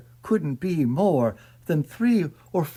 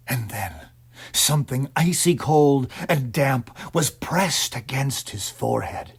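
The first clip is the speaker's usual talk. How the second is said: ordinary delivery, nothing marked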